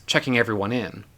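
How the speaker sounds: ordinary delivery, nothing marked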